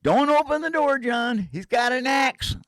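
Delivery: very high, loud alarmed cry; frantic warning. Retold or imitated. Mark frantically warning about the axe, high and loud. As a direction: yell the warning in panic.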